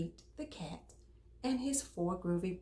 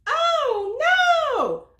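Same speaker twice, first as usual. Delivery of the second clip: high, loud playful "oh no"; mock dismay; 15 dB louder than usual. Storyteller to listening kids, hammed-up high alarm. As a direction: gasp it for the kids in fun.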